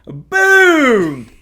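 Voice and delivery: shouted gunshot boom, falling; loud boom. Retold or imitated. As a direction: yell it like a rifle shot. Gunshot sound effect.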